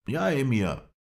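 high, loud assent; agitated ally. A narrator's reading. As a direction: cry out the answer, pitched high. Mohammed Emin urgently agrees.

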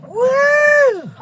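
high loud whoop of joy; a high, loud cheer at finding the eggs; excited whoop. Real, not acted. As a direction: let out a big happy woo.

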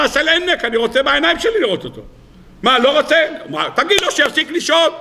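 high-pitched acted protest; mock insistence. Retelling the emperor wanting to see with his own eyes, mock-insistent.

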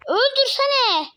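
high, drawn-out yell at the game; desperate gamer yell; frantic yell. Real, not acted. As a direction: stretch it out, yelling for the kill. Frantic gameplay, urging a kill.

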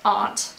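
"aunt" said as AHNT; broad-A aunt. Shown as a demonstration. Vlogger showing how other people say it, "aunt" as AHNT. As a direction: say "aunt" with an open ah vowel. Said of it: "so weird when people say".

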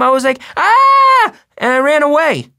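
high, loud kid acting out his scream; screaming little brother. A narrator's reading. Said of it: "Jamie, screaming".

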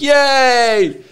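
high, bright-eyed yay; mock cheer. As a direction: cheer like a fan. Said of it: "half the audience was like".

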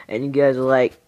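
usual speaking voice

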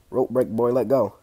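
usual speaking voice

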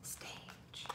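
hushed whisper. Real, not acted. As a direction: whisper it softly. Puppet show about to start.

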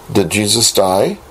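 normal talking voice

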